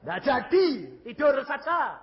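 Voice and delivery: high, loud dismissal; loud exclamation. Retold or imitated. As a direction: call it out with comic force. Retells exclaiming to his wife. (seruan keras bernada tinggi)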